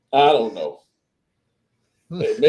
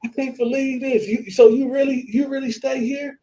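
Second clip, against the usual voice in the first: high pitched, rising to a shout; gushing disbelief that builds to a yell; starstruck fan; 10 semitones higher than usual. Retold or imitated. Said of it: "She was like".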